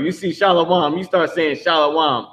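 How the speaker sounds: Shalom said both times as "SHAH-lo-wahm"; mistaken pronunciation. Shown as a demonstration. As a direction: give Shalom three syllables with a "wa". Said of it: "not knowing that it's Shalom".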